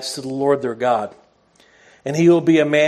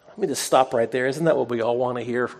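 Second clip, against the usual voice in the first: ordinary delivery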